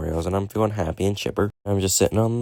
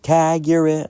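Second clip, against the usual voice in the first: put-on high, bright-eyed child taunt; taunting kid voice; 9 semitones higher, 3 dB louder, slower than usual. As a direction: play a cheeky little kid, high. Kid taunting while tagging him.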